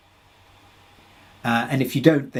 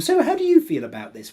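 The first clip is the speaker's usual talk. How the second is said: mock therapist question; 11 semitones higher, 3 dB louder than usual. Mocking a stock therapist question.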